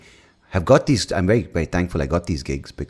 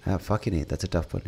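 normal talking voice

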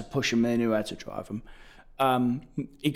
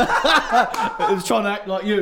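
laughing; 6 semitones higher, 5 dB louder, slower than usual. Real, not acted. Laughing while explaining the impression.

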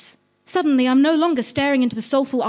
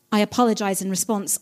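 ordinary talk

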